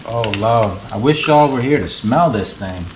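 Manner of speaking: normal talking voice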